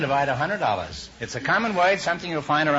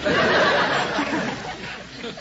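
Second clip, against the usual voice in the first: high breathy laugh; 12 semitones higher than usual. Real, not acted. Host laughing at his own joke to the guest. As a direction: let a laugh burst out.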